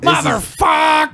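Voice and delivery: high, drawn-out mock shout; mimicked furious yell. Co-host copying the ranting man in the video, mock yelling. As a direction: draw it out in hammed-up outrage.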